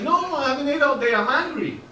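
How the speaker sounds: put-on high, insistent Latin American accent. Friend explaining he hasn't eaten, insistent Latin American accent. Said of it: "a country in Latin America".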